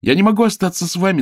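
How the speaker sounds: high, loud and quick; ringing voice. A narrator's reading. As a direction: ring out across the city. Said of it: "голосок вдруг стал таким звонким".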